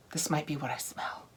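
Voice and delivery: hushed, curious whisper. Real, not acted. Vlogger sniffs a sugar scrub, whispering. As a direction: whisper as if catching a scent.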